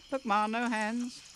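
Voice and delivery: high-pitched proud little bat; boasting kid bat. Acted. Bat calling to its mother, high and proud. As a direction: show off to mom, upside down.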